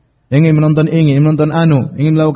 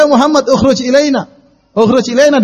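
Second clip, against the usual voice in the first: high, loud call of the name; calling loudly; 7 semitones higher than usual. Retold or imitated. Bedouins yelling for Muhammad outside his rooms, calling loudly. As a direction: shout the name without respect. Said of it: "dengan suara keras panggil nama Muhammad".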